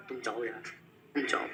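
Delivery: low, very soft plea; hushed shooing. Acted. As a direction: quietly send the vendor away. Suitor shoos vendors away quietly. (धीमी आवाज़ में टालना)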